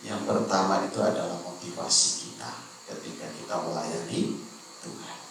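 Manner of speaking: ordinary delivery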